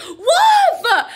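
high, loud alarmed shout; crying wolf. Acted. Boy fakes alarm to dodge violin, high loud shout. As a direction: shout it to skip the lesson. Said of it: "He cried".

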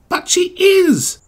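high, stretched-out insistence; pleading. A narrator's reading. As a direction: plead to be believed. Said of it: "Thomas pleaded".

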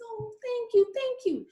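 very high, delighted little fish; overjoyed thanks. Acted. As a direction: gush thanks, squeaky with joy.

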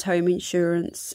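normal talking voice

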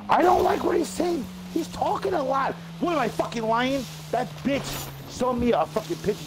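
very high, strained protest; high-pitched indignant rant. Acted. Ranting back at an accusation of lying.

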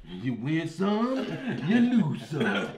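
slow movie-quote impression; drawn-out, jokey quoting with a laugh; John Witherspoon. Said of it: "the message by John Witherspoon".

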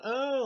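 high, loud imitated groan of disgust; hammed-up recoil at something gross; mock disgusted "oh". Teenager turns away from the body, high loud mock "oh". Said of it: "starts going".

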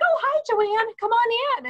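high, gushing mock greeting; imitated friendly welcome. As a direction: play crew waving in their favorite guest. Acting crew welcoming her mother.

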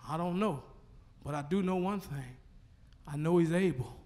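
hushed testimony. Real, not acted. Preacher confessing faith to church, hushed. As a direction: confide it quietly.

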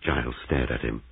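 plain reading voice

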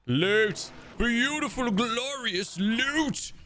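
high, drawn-out cry of delight; gleeful excitement. Real, not acted. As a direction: revel in the treasure, stretching the last word. Gleeful at finding loot.

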